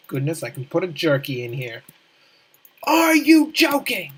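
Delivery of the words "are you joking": loud, high, slow amazement; amazed. Real, not acted. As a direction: gasp it in disbelief. Delighted surprise at jerky.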